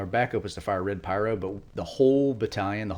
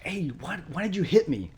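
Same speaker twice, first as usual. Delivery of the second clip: higher-pitched, indignant mock complaint; retold protest; 10 semitones higher, 3 dB louder than usual. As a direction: act out a buddy demanding an explanation. Marine protesting in retold combat.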